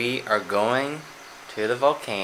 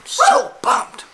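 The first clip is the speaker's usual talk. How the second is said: much higher, loud hype squeal; excited squeal; 8 dB louder than usual. Real, not acted. Hyped about volcano trip.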